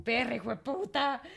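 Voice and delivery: playful high insult between sisters; mock insult. Teasing her sister Raquel with a mock insult. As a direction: toss the insult like a friendly greeting.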